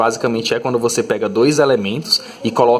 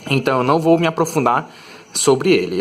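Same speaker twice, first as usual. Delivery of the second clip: ordinary talk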